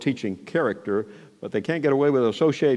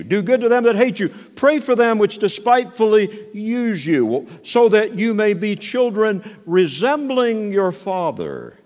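higher, slow preaching voice, pressing each line; preacher voicing Jesus' command, slow and raised; commanding proclamation; 6 semitones higher, slower than usual. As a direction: declare it like a prophet.

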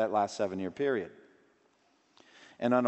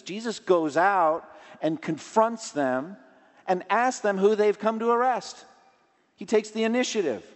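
raised, ringing sermon voice, higher and louder; preaching at full force; 9 semitones higher, 6 dB louder than usual. Acted. Pastor urging the congregation to flee temptation, preaching loudly. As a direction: drive it home from the pulpit.

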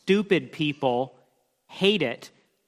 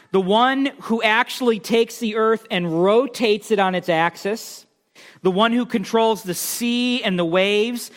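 raised, driving pulpit voice; preaching at full force; 6 semitones higher, 3 dB louder than usual. Acted. Preacher warning the congregation about idolatry, full force. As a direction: hammer the point home to the congregation. Building God's power in sermon.

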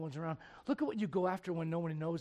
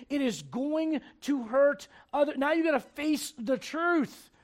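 higher, louder sermon voice; forceful preaching; 9 semitones higher, 12 dB louder than usual. Acted. Preacher reading David's reaction to church, forceful preaching. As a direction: drive the verse home.

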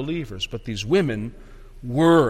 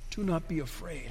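much softer, reflective; hushed repeat; 13 dB quieter than usual. Real, not acted. Preacher letting the angel's words sink in, hushed. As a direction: drop right down, almost to yourself.

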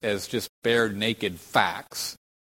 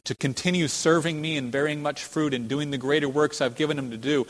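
ordinary delivery

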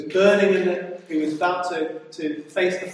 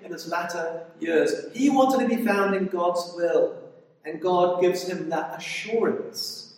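normal talking voice

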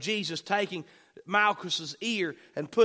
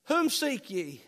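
imagined voice of Jesus; 6 semitones higher, slower than usual. Acted. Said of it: "I'd love to hear the inflection in his voice".

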